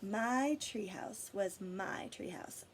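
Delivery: ordinary delivery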